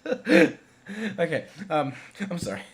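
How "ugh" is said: high, loud shuddering "ugh"; imitated shudder. Reviewer showing how the film scared them last night, comic shudder. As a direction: act out the creeps down your neck. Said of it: "felt the back of my bloody neck going".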